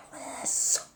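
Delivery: hushed whisper of the name. Acted. Vlogger whispers the name of today's topic. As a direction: breathe the name softly.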